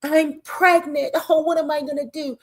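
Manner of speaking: imitating a dramatic crybaby; mock whining. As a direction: ham up a whiny pregnant sob. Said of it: "crying and whining like a little bitch".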